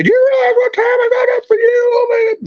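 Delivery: very high shrill mom falsetto; imitating a complaining mom; mock nagging mother. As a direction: whine like a martyred mother. Yelling and screaming.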